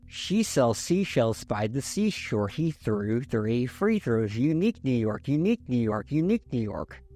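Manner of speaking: connect each word to the next, pen between teeth; legato. Shown as a demonstration. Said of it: "Now let's do legato".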